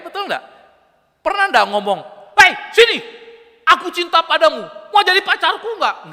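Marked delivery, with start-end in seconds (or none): 2.3-6.1 high, loud, brash call across the room; mock crude yelled pickup line; rude shouted proposal. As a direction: holler the love confession like a lout. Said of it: "Nggak kayak gitu kan?".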